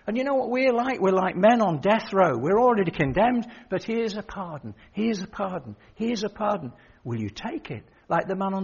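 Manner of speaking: preacher pleading with the congregation; preaching. Acted. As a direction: hammer the gospel home.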